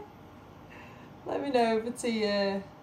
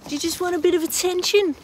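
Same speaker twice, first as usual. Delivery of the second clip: fast, high mock dad tease; imitating dad; 10 semitones higher, 8 dB louder, faster than usual. Dad teasing little sister, high fast mock voice. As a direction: playfully mimic dad teasing. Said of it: "My dad used to say".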